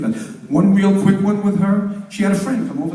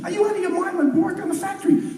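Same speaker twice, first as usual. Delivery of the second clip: screaming; 12 semitones higher than usual. Acted. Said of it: "starts screaming".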